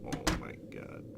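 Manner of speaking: hushed, frightened whisper; scared whisper. Real, not acted. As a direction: breathe it out in fear. Scared whisper at creepy room.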